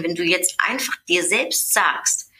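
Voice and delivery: normal talking voice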